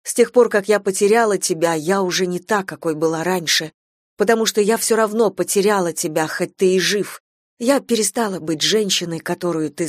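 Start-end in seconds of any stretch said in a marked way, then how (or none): none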